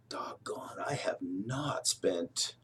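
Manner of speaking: breathy whispered doggone under the breath; whispered aside. Real, not acted. To viewers, a hushed whispered doggone. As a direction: drop to a whisper for the curse word. Muttered aside mid-sentence.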